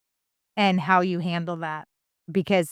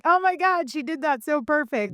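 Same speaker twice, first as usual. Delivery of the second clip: amazed delight; 9 semitones higher than usual. Retold or imitated. Mom retelling her reaction to her kid's imitation, amazed. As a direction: gush in amazement at a spot-on copy.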